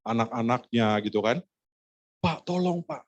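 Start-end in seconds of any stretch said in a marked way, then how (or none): 2.2-3.0 high-pitched, lightly imitated tearful begging; understated imitation of pleading family; mock wailing plea. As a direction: act out a weeping wife's plea. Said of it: "sudah meraung-raung lah, menangis-nangis lah".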